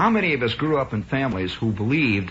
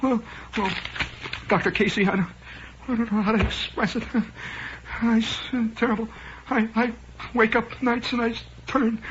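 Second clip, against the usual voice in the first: high, halting, hammed-up nervousness; worried patient; 10 semitones higher than usual. Patient confessing terrible symptoms to doctor, mock anxious. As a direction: stammer out symptoms with long pauses. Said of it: "he's worried, he's sweating".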